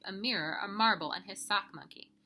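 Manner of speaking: usual speaking voice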